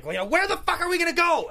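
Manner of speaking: high, loud furious shout; angry outburst. Retold or imitated. Father finds out there's no army or cure, angry yelling. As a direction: imitate a man blowing up in anger. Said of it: "he's just angry".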